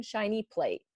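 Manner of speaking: ordinary delivery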